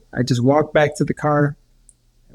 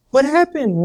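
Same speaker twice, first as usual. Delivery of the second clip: much higher, stunned question; mom's astonishment; 11 semitones higher than usual. Retold or imitated. Mother seeing the man come back to life, astonished and high. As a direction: gasp it out in disbelief. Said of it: "She's like".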